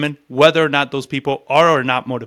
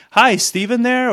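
timid high opener; 8 semitones higher than usual. Shown as a demonstration. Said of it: "I don't call and be like".

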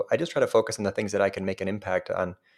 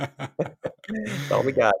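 laughing; 5 semitones higher, much slower than usual. Real, not acted. Laughing over a shared joke.

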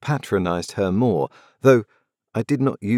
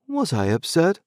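plain reading voice

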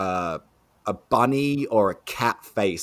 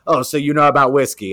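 loud, quick, higher-pitched challenge; grilling; 6 semitones higher, 9 dB louder, faster than usual. Retold or imitated. Rep quizzing the new bar manager, grilling him. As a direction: imitate a rep putting the new guy on the spot. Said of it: "she's grilling me like this".

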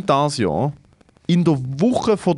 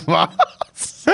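laughing disbelief; 13 semitones higher than usual. Retold or imitated. Retelling confused reply to neighbor.

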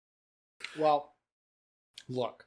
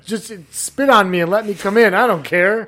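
mock drunk gambler; 3 semitones higher, 13 dB louder than usual. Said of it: "and you're like".

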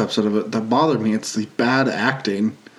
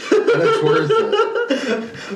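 laughing; much slower than usual. Real, not acted. Laughing, drawn out.

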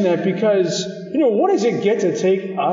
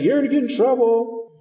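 raised-pitch warning, not truly shouted; mild mock yell; 6 semitones higher than usual. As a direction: imitate a prophet's yell lightly. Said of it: "Jonah's kind of the one yelling".

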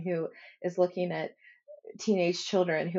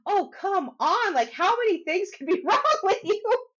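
mock exasperation; 12 semitones higher, 4 dB louder than usual. Son fed up with mom's endless self-work, amused exasperation. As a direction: imitate a fed-up teen, laughing through it. Voices son's exasperation, laughing.

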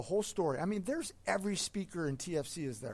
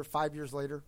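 ordinary talk, nothing marked

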